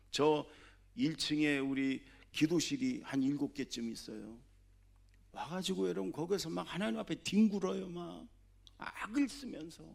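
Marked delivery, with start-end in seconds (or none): 5.3-9.8 high fervent urging. Real, not acted. Urging congregation to cry out.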